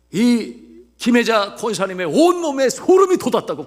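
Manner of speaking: high, loud pulpit exhortation; full-force preaching. Real, not acted. Preacher urging congregation to believe, full-force preaching. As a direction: drive the promise home to the congregation.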